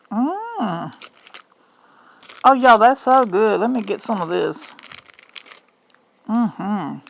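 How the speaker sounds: ordinary delivery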